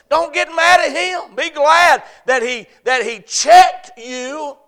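loud, high, driving sermon delivery; full-force preaching. Real, not acted. Pastor preaching to the congregation, full force. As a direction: preach it out, ringing and urgent.

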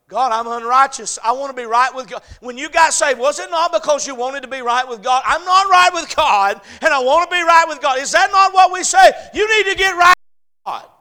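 loud, high, building to a shout; full-force preaching. Real, not acted.

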